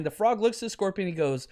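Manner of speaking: normal talking voice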